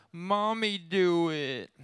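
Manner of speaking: put-on high whiny toddler voice; whiny little girl. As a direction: whine it like a tired toddler. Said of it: "Kylie starts crying".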